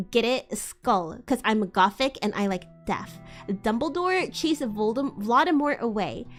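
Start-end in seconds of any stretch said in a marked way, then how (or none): none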